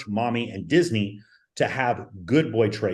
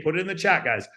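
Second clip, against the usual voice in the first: ordinary delivery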